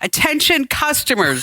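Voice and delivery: high, laughing loudspeaker call; mock store announcement. Cashier on the PA to two shoppers, laughing announcement. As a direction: announce to an empty store over the speaker. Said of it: "she gets on the P A".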